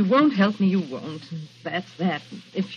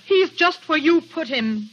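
slow, louder, each word pointed; cold accusation; 5 semitones higher, 4 dB louder, slower than usual. Acted. Dorothy accusing Rolfe of framing Jerry, slow and cutting. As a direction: lay the blame squarely on him.

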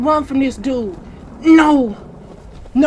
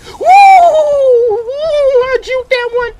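very high, excited cry; high-pitched jubilant holler; elated whoop; 10 semitones higher, 6 dB louder, slower than usual. Real, not acted. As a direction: whoop with relief at the escape. Just dodged the pursuer.

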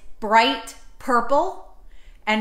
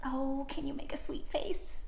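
soft, hushed sweet voice; tiny, gentle sweetness for kids; 3 semitones higher, 13 dB quieter than usual. Acted. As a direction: coo it softly. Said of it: "Dinosaur sweet".

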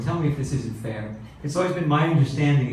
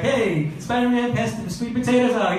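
pretend call to Spider-Man; 8 semitones higher than usual. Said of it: "you're like".

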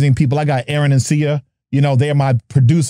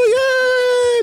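excited squeal. Real, not acted. Cheering guest arrival.

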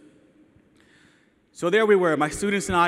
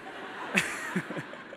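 soft laugh; 10 dB quieter than usual. Real, not acted. Laughter over twin names.

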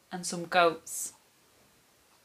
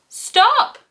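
put-on high, loud children's cry; 8 dB louder than usual. A narrator's reading. Children to troll on the bridge, high loud cry. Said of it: "cry the children".

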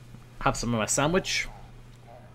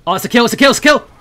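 much higher, louder rising yelps; excited shout; 11 semitones higher, 13 dB louder, much faster than usual. Real, not acted. Gamer cheering a kill he thinks he has, excited shout.

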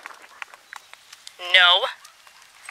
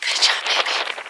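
whispered praise. Real, not acted.

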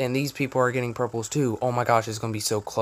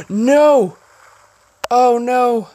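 panicked shout; 11 semitones higher, 13 dB louder, much slower than usual. Real, not acted. Field of view setting ruined.

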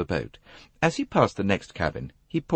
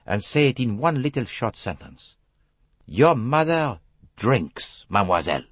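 Hercule Poirot; 8 semitones higher, 4 dB louder than usual. Acted. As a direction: state hard facts bluntly in a French accent.

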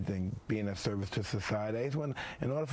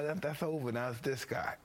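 usual speaking voice

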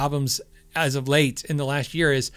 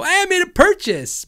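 mock surprised exclamation; 13 semitones higher, 5 dB louder than usual. Drunk buyer surprised at purchase.

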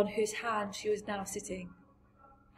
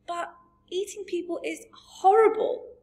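put-on high, louder outcry from a shocked child; horrified cry; 11 semitones higher, 6 dB louder than usual. A narrator's reading. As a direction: cry out in dismay, pitched well up. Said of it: "Sophie cried".

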